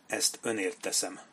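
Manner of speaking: soft, hushed private vow; inner thought. A narrator's reading. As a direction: say it quietly to yourself. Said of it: "gondolta Sharp".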